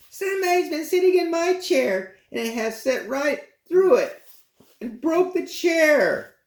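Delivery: put-on very high, wailing baby bear, slowing at the end; tiny bear wailing over its broken chair; crying baby bear. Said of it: "cried the little baby bear".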